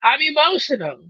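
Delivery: high, loud mock panic, hammed up; mock freak-out. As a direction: play it as a hammed-up emotional meltdown. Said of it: "it goes like this".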